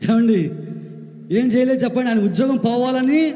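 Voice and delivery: fervent preacher's challenge; preaching at full force. Acted. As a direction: drive the point home from the pulpit. Preaching emphatically to congregation.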